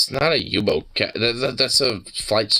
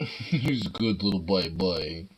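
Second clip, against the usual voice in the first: talking to a dog; 8 dB quieter than usual. Real, not acted. Soft slow cooing at pet.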